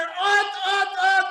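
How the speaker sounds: high, loud excited ut ut ut. Acted. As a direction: blurt it out like you can hardly contain it. Mimics the spies' excitement.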